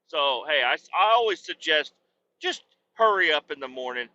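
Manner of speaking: ordinary delivery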